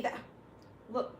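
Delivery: ordinary delivery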